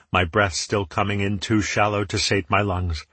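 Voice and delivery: ordinary delivery, nothing marked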